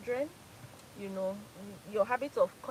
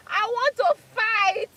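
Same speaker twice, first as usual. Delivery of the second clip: much higher and louder, slow, drawn out; hammed-up battle cry, laughing; fighting shout; 12 semitones higher, 12 dB louder, much slower than usual. Shown as a demonstration. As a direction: let the fighter inside burst out. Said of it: "the Port Harcourt inside of me".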